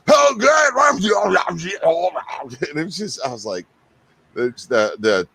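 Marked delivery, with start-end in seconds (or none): 0.1-1.6 loud, high mock Austrian shout; Schwarzenegger. Co-host re-enacting his botched radio ad, hammed-up Austrian bellow. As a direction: holler it in a hammed-up Austrian accent. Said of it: "I did it like Schwarzenegger".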